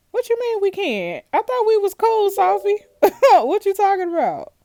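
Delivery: high, loud hurt protest; outraged disbelief. Real, not acted. Gamer protesting to Sophie, loud and incredulous. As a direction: yell at the screen, betrayed and laughing. Gamer shocked by partner's refusal.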